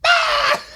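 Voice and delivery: short, very high, loud scream; imitated scream. Teacher imitating kids in a lockdown drill, sudden high scream. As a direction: shriek like a panicking kid.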